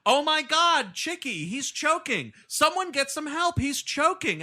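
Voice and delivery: hammed-up screechy panic, high in the voice; panicked woman. The bakery woman crying for help over Chicky, in a mock panicked high voice. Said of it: "And it's just like".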